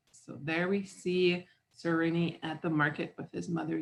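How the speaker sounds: ordinary delivery, nothing marked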